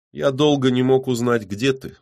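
plain reading voice

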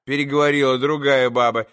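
usual speaking voice

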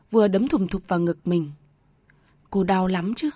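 ordinary delivery

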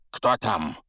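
sharp loud call at the door; shout. A narrator's reading. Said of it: "крикнул дядя Вернон". (выкрик)

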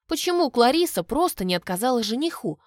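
ordinary delivery

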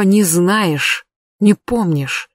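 usual speaking voice

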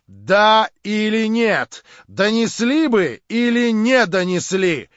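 loud, slow, high-pitched interrogation; shouted demand. A narrator's reading. Verkhovensky interrogates the major before the gathering, shouting slowly. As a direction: shout each alternative slowly, demanding an answer. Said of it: "крикнул Верховенский".

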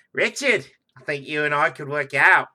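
usual speaking voice

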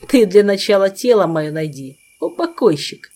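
loud, sneering taunt; mocking. A narrator's reading. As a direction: jeer it out, mocking. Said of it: "насмешливо бросил призрак".

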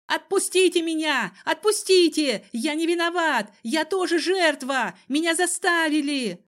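high, loud frightened pleading; panicked squeal. A narrator's reading. Cousin begs to be released by his captor, squealing. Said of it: "что тот завизжал".

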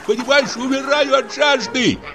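a touch of tearful whining; whiny tearful. Acted. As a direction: whimper like a lost drunk. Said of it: "плачущим голосом".

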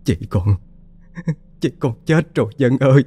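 a mother realizing her daughter is dead; despairing cry. A narrator's reading. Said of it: "thốt ra một câu tuyệt vọng". (giọng tuyệt vọng, nghẹn rồi vỡ òa cao)

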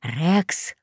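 soft, hushed call; whispered. A narrator's reading. Boy whispers to his dog, hushed. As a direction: call the dog under your breath. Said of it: "шепнул я собаке".

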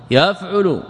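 يفعل pronounced yaf'ulu, u following the ayn; damma on the ayn. Shown as a demonstration. Teacher naming each vowel for students, damma demonstration. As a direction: put a clear u in the middle of يفعل, yaf'ulu. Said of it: "ضم العين".

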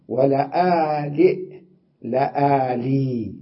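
لآلي drawn out, final hamza turned to ya; slow softened hamza. Shown as a demonstration. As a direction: stretch ولآلئ then soften it to لآلي. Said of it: "هكذا". (نطق لآلي بتسهيل الهمزة ببطء)

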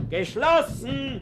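loud, high and slow through the door; drawn-out loud call to turn him away; calling out closed. Acted. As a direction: shopkeeper shouting closed at the door.

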